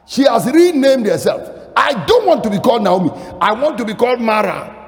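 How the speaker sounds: high, shouted sermon with hammered repetition; impassioned preaching. Acted. Preacher voicing Naomi's bitter reply to the townspeople, impassioned preaching. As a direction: cry out each refusal at full force.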